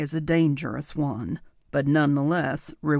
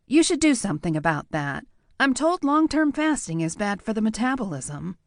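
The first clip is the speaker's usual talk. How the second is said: higher, quick teasing woman's voice; higher-voiced dry mock advice; wry teasing; 7 semitones higher than usual. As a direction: needle him with mock concern.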